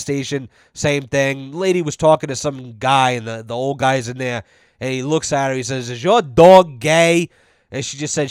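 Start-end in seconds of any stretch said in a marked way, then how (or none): 5.9-7.3 loud, drawn-out blunt question; retold nosy old man, booming; imitated old man. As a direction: blurt it out slowly and loudly, as the old guy.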